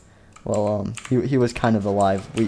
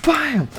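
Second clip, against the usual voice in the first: high-pitched squealed bam; impact noise. Acted. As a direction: punch out a high bam for a kick. Sound of the kick.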